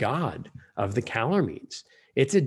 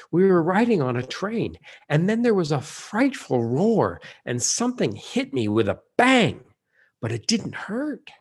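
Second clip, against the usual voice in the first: vivid retelling; 5 semitones higher, 4 dB louder than usual. Acted. King Edmund recounts the crash.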